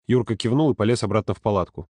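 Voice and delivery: plain reading voice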